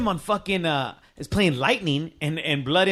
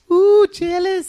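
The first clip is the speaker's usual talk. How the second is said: high, slow mocking coo; drawn-out high-pitched taunt; mock teasing; 7 dB louder, slower than usual. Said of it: "you would hear".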